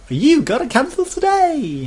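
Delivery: squeaky high, loud sales pitch; cheery pushy salesperson. Retold or imitated. Sky agent pushing cancellation, high cheery salesperson voice. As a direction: ham up an eager agent rushing him through. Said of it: "Cheery, happy".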